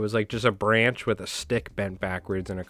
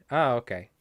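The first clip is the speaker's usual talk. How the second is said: usual speaking voice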